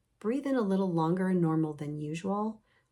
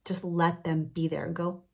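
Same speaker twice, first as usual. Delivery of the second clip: usual speaking voice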